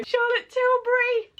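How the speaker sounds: high, loud thrilled gasp of the brand name; squealing surprise; delighted gasp. Real, not acted. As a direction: gasp it out in disbelief. Spots prized brand.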